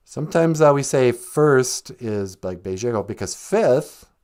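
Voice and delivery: usual speaking voice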